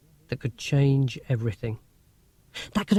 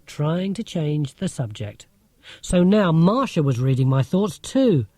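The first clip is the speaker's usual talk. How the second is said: usual speaking voice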